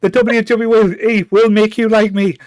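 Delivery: loud, high Roman Reigns promo voice; wrestling promo. Pretend. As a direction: hype it up like a wrestler on the mic. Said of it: "like, doing a wrestling promo now".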